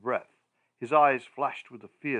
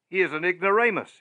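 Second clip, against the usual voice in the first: put-on louder, higher drunken interruption; interrupting; 7 semitones higher, 7 dB louder than usual. A narrator's reading. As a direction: cut in loud and high, scornful. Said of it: "interrupted my friend".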